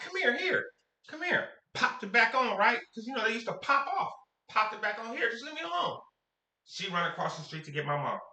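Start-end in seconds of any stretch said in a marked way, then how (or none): 0.0-1.5 put-on high, impatient childish coaxing; lightly voiced kid waving her back. Coaxing sister back with doll. 5.2-6.0 put-on high, fed-up childish brush-off; lightly voiced irritated little boy; annoyed kid. Handing the doll back, annoyed.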